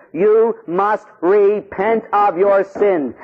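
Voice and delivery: each word set apart; slow, emphatic. Real, not acted. Priest laying down church teaching, slow and emphatic. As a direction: spell it out word by word.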